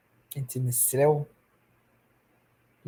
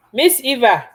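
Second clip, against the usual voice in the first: louder, higher call across the room; raised, high call to Eva to wake her; 8 semitones higher, 9 dB louder than usual. Real, not acted. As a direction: call Miss Eva from afar. Calling out to Eva off-mic.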